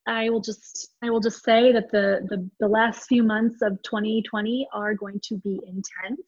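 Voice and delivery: ordinary talk, nothing marked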